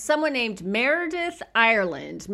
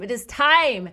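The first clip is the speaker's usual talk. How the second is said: "time" said louder and higher, landing firmly; building excitement, louder; emphatic announcement; 4 semitones higher, 6 dB louder than usual. Shown as a demonstration. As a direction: proclaim it like a big reveal.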